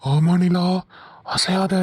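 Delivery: low, husky warning; husky spooky voice. Acted. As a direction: freeze her with a creepy low hush. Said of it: "husky voice". (தாழ்ந்த கிசுகிசுப்பான எச்சரிக்கை)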